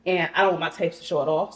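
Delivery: ordinary delivery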